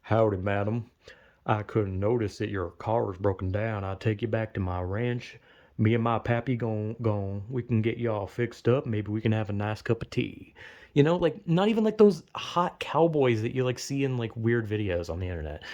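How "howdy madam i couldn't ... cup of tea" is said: understated gentleman cowboy, lower and gentle; gallant cowboy. Retold or imitated. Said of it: "the cute country boys".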